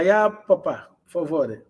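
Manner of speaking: usual speaking voice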